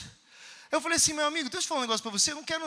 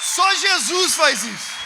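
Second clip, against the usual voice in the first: slow, loud, drawn-out proclamation; triumphant shout; 3 semitones higher, 9 dB louder, much slower than usual. Real, not acted. Preacher shouting the lesson to his congregation. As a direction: proclaim it like a victory. Punchline to the dealer.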